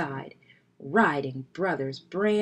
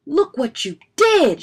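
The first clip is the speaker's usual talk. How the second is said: high, very loud accusation; angry shout; 9 semitones higher, 11 dB louder than usual. A narrator's reading. Brother shouting at Fred over the bent wheel, angry. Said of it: "shouted Brother".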